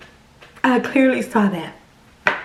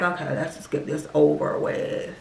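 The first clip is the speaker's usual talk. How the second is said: resigned mutter; 7 semitones lower, 7 dB quieter than usual. Retold or imitated. Dreading the visit.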